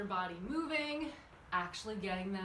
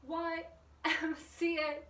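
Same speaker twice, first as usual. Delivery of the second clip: calling out; 6 semitones higher, 4 dB louder than usual. Real, not acted. Calling out letters fast.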